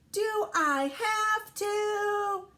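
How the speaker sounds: put-on high, very slow kid whine; David's complaint. A narrator's reading. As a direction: drag it out like a reluctant child. Voicing David refusing breakfast.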